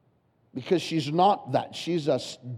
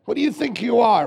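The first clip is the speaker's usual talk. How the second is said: loud, higher scornful taunt; mock scornful challenge; 7 semitones higher, 6 dB louder than usual. Hometown crowd sneering at Jesus, loud and scornful. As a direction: throw the sneer at him.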